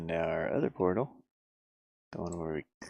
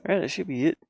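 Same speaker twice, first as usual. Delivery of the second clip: higher and louder, pleased it works; excited; 10 semitones higher, 9 dB louder, much faster than usual. Real, not acted. Developer cheering once the portal setup seems finished. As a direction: burst out happily, job done. Satisfied after fixing portals.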